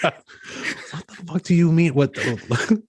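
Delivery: odd put-on voice. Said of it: "What is this voice you're doing?".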